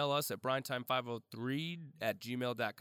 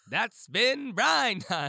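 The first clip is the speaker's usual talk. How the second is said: high, loud, slow hawker's call; clownish newsie cry; 8 semitones higher, 7 dB louder, much slower than usual. Pretend. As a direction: stretch it out like a goofy crier. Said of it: "you sort of sounded".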